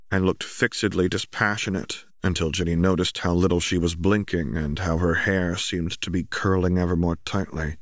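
ordinary delivery, nothing marked

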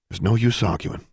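usual speaking voice

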